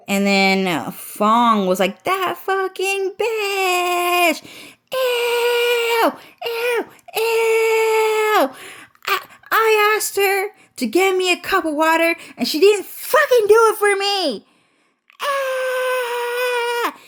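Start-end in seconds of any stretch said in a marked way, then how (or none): 2.0-14.5 shrill, very high crying scream; mock the sister's wailing screaming fit, way up high; imitated screaming tantrum. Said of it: "she kept screaming". 15.2-17.0 wordless high wailing shriek; shrill crying scream. Retold or imitated. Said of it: "she kept screaming".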